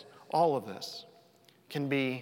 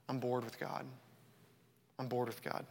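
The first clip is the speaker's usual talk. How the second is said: subdued confession; 4 semitones lower, 9 dB quieter than usual. Retold or imitated. Preacher voicing believers who feel far from God, hushed and low. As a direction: quietly voice a disillusioned believer.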